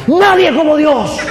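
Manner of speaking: high, loud, slow triumphant cry; fervent shout. Retold or imitated. The gang member bursts in answering the preacher, shouting. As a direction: bellow it out through the doorway.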